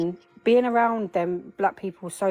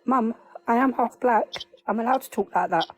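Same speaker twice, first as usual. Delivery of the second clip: imitating son; 4 semitones higher than usual. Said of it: "He says".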